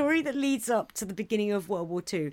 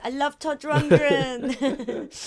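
lightly voiced, slow, laughing doomed man; 5 semitones higher, 4 dB louder, much slower than usual. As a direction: drag it out, laughing, lightly in character. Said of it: "The last guy was like".

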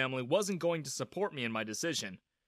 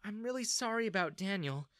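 sad; 6 semitones higher, 4 dB quieter than usual. A narrator's reading. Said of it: "Jessica said sadly".

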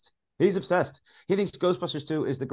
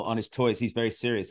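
normal talking voice

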